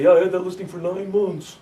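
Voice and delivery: mock careless agent; wrong way to say it. Shown as a demonstration. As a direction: toss it off like nothing. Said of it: "Now, you don't say".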